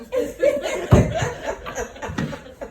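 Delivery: high, breathless cackle; loud wheezing laugh; cracking up. Real, not acted. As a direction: burst out laughing at your own story. Laughing after the impression.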